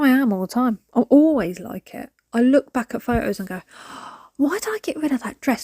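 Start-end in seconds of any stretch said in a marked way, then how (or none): 3.7-4.2 quick acted gasp; small dramatic gasp; mock regretful gasp. Acting her reaction to old photos. 4.4-5.6 higher-pitched mock dismay; playful self-reproach, pitched up; acted regret. Acting regret at old photos.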